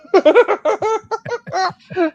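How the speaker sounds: real loud laugh; loud high laughter. Real, not acted. Host laughing at using his Susie voice, loud and high.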